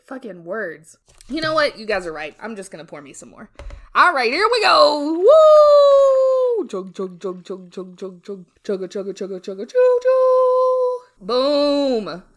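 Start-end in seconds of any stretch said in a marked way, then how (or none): 3.9-6.7 loud, high, drawn-out woo; excited whoop. Real, not acted. Whooping while pouring a drink. 6.7-11.0 chug along, then hoot like a steam whistle; playful train imitation. Imitates a train while pouring.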